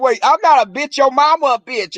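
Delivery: indignant snap-back. Acted. Woman snapping back at a boyfriend.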